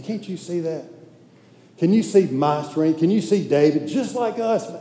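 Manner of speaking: usual speaking voice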